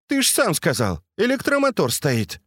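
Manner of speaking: high-pitched, smiling reminder; smiling teasing. A narrator's reading. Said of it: "улыбнулась Катя". (высоким голосом, с улыбкой)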